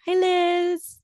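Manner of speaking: excited greeting. Real, not acted. Host greets the guest.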